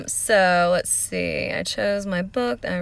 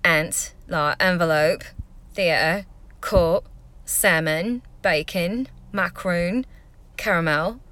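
English Essex accent, word by word; much slower than usual. Shown as a demonstration. Vlogger showing accents from a UK tour, Essex accent. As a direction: read each word as if from Essex. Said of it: "I'll do, like, Essex first".

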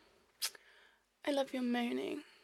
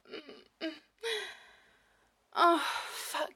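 ordinary talk

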